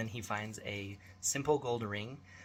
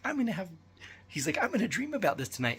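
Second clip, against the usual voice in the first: put-on high, fast worried child voice; spooked kid; 9 semitones higher, faster than usual. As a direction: kid dreading nightmares. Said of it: "my son's like".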